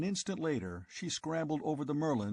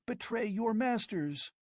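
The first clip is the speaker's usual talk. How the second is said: higher, feverish visions of riches; girl tempting her lover with an empire; passionate scheming girl; 9 semitones higher than usual.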